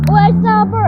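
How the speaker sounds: loud, thrilled call of discovery; excited shout. Real, not acted. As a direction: blurt it out, delighted. Spotting bird, shouting out.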